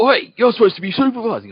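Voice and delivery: high, loud barking cop telling him off; cop yelling. Retold or imitated. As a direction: shout it like an angry cop scolding the passenger. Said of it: "they go".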